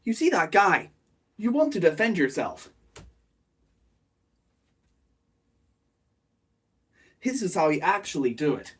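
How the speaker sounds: lightly voiced mock short-video presenter; a hint of a hyped clip narrator; playful parody of a shorts hook; typical shorts voice. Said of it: "what would a short be? Like, a typical".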